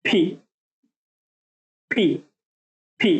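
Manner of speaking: ফি with both lips pressed, then a breathy release; lip-folded fee. Shown as a demonstration. Teacher drilling ফি with folded lips, clearly. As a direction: puff ফি out through folded lips. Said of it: "lipstick দেওয়ার পরে ঠোঁট ভাঁজ করে এভাবে".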